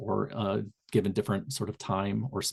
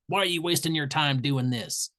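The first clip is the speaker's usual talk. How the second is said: put-on higher-pitched challenge in a Southern Indiana accent; 5 semitones higher than usual. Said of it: "That's my Southern Indiana accent".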